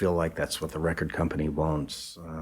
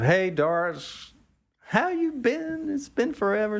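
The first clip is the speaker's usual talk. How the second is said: much higher, slow and sing-song; delighted greeting. Retold or imitated. Greeting Doris after years, in a high, drawn-out happy voice. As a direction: stretch it out like greeting a long-lost friend.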